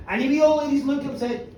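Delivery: plain reading voice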